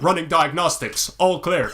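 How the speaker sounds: a hint of a robot reporting system status; measured, mildly mechanical robot imitation. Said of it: "we were talking to this robot".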